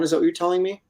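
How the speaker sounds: ordinary delivery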